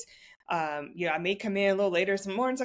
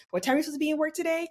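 snide question; 7 semitones higher, much faster than usual. Retold or imitated. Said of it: "some snide comment".

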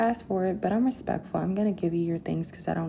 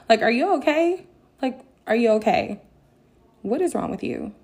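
raised, higher-pitched disbelief; incredulous; 6 semitones higher, 5 dB louder than usual. Retold or imitated. Stunned at how the ex spoke to her, incredulous. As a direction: ask it in indignant disbelief.

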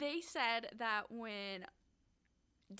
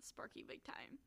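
breathy laughing whisper, pitched up; whispered giggle; 10 semitones higher, 6 dB quieter than usual. Real, not acted. Cohost teasing about the gross nickname, giggling whisper. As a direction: giggle it out under your breath.

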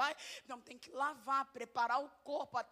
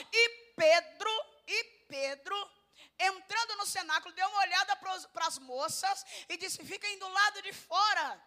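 louder, higher reading; loud scripture reading; 6 semitones higher, 7 dB louder than usual. Real, not acted. Preacher reads Peter sending the women out, loud reading. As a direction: proclaim the verses.

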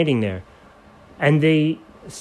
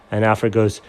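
ordinary delivery

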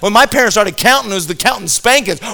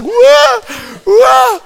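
very high, loud, shocked cry; the hurt boy yelps in shock at his own arms; panicked whoa; 6 dB louder than usual. Retold or imitated. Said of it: "Jamie's looking at us like".

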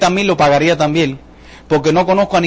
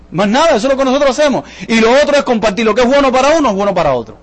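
usual speaking voice

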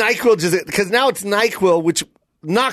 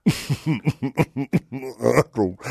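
low, slow, drowsy voice; slow motion; 10 semitones lower, 6 dB quieter, slower than usual. Retold or imitated. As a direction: drag the words, drugged and sleepy. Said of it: "That's exactly what I hear when I do NyQuil".

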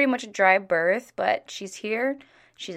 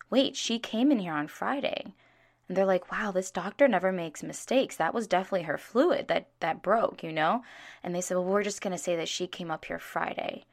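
retold hushed talk; 7 dB quieter than usual. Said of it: "I heard them whispering".

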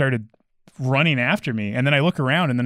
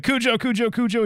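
high-pitched repeated call, only slightly raised volume; mock screaming dog's name; 11 semitones higher than usual. Owner calls his attacking dog back, mock scream. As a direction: imitate frantically calling off a dog. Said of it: "screaming the dog's name".

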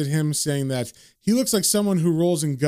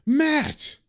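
a much higher cry of realization; sudden yell; 11 semitones higher than usual. Retold or imitated. John realizing he forgot his friend, sudden yelled name. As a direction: blurt the name as it hits you. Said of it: "John yells out".

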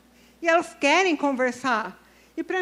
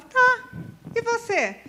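breezy reply; 9 semitones higher, slower than usual. Retold or imitated. Her breezy reply to crying person.

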